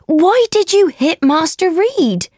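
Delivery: high, scolding question; high-pitched servant's reproach; crying out. Acted. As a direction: demand an answer, shocked. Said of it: "cried Abbott".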